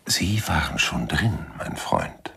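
slow, whispered "mein Freund"; a quiet, knowing unmasking; whispered reveal. Acted. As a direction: whisper the truth softly. Detective springing the reveal.

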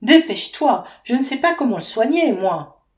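high, loud and fast in fear; frightened mother, rushing; panicked urging. A narrator's reading. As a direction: hurry her on in a panic. Baby choking, urgent plea.

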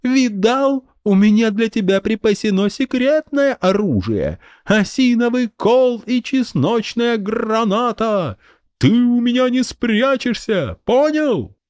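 put-on high kid bragging; boastful child. A narrator's reading. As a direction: brag like a kid with a stick. Boy brags about weapon.

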